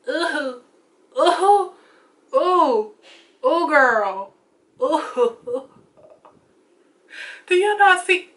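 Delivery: rising high squeals of delight; excited ooh. Real, not acted. Vlogger to viewers, gushing high over her new highlighter. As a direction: gush over the glow like it's magic.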